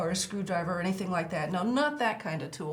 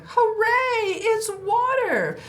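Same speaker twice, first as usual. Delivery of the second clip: high, loud slow hooray; excited cheer; 11 semitones higher, 7 dB louder, slower than usual. Acted. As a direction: cheer for the crow as it finds water. Excited crow finds water.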